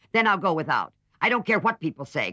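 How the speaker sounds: loud, defiant refusal; defiant cry. A narrator's reading. Jo refusing to care what people say, loud and defiant. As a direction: brush it all off with a stubborn outburst. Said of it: "cried Joe".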